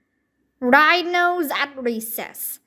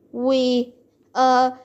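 stammering. A narrator's reading. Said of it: "Jack stammered".

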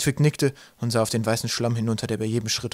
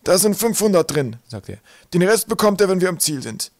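high, raised professor's voice; businesslike professor, pitched up; professor driving a bargain; 8 semitones higher, 6 dB louder than usual. Acted. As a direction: state the terms high and firmly. Narrator plays professor striking a deal.

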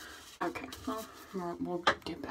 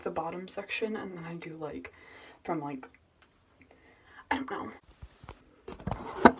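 normal talking voice

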